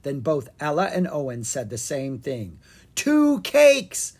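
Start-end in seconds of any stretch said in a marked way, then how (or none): 2.9-4.1 high, loud shout together; yelled. Acted. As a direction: shout it in triumph. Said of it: "they yelled".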